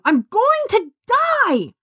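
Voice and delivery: high, slow despairing scream. A narrator's reading. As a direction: scream it at the chasm. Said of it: "she screamed".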